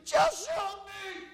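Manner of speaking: high, loud screams, building to slow shout; burning man screaming at the policeman, high loud agony; screaming at the top of his lungs. Retold or imitated. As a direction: beg for death in agony. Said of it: "he screamed at the top of his lungs".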